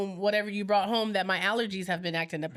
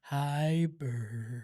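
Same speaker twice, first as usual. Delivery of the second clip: much lower, deep greeting; 7 semitones lower, 4 dB quieter than usual. Acted. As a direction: drop the voice way down. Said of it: "That's what you sound like".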